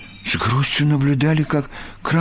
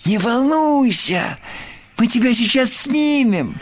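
calling out; 12 semitones higher than usual. A narrator's reading. Friends shout up to the one stuck in the tree, calling out. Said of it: "закричали Крош с Ёжиком".

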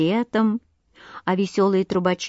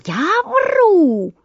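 much higher, louder, indignant; outraged yell; 9 semitones higher, 4 dB louder than usual. A narrator's reading. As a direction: bellow back in disbelief. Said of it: "орал Воробей Воробеич".

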